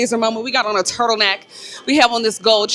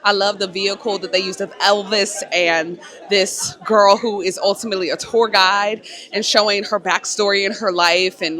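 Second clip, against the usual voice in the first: usual speaking voice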